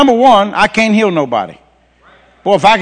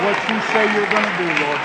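hushed, softer praying voice; quiet, urgent aside to God; earnest prayer; 6 dB quieter, faster than usual. Real, not acted. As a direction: petition God softly and quickly. Drops into prayer.

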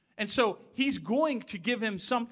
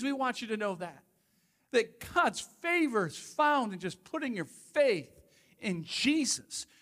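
normal talking voice

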